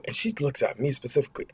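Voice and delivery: ordinary talk, nothing marked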